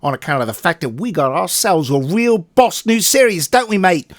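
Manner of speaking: loud, high cockney geezer banter; high, loud cockney bloke voice; mock London geezer accent. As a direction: ham up a brash London lad. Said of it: "I'm gonna drop this accent".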